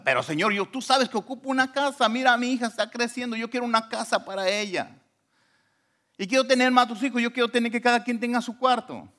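high-pitched, sustained plea; acted-out bargaining with God; insistent pleading. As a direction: plead your case, pitched high and pressing. Acts arguing his case with God.

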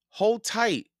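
high, loud call to hold on; urgent urging. A narrator's reading. Jack to Annie sinking in mud, urgent.

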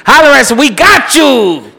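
loud, high promo shout; hammed-up sponsor hype. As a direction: sell it like a radio spot. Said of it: "ad read".

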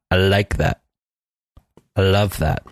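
"like" and "love" with a carefully placed L; effortful L. Shown as a demonstration. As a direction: try hard to land the L in "like" and "love". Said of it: "it sounds like I'm trying".

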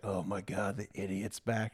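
lightly voiced exasperated voice; mock weary voice. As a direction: sigh it out like a fed-up inner voice. Voicing the omnipresent voice.

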